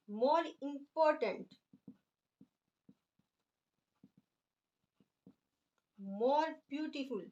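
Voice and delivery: very slow, each word drawn out for writing; slow dictation. Shown as a demonstration. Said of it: "अब हम देखें इनकी second degree बनाते हैं". (बहुत धीरे-धीरे लिखवाते हुए)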